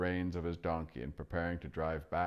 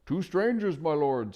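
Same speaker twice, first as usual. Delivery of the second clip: high, louder hooting owl; 11 semitones higher, 7 dB louder than usual. Acted. Owl politely hooting to the deaf dwarf. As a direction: hoot up at a deaf old dwarf.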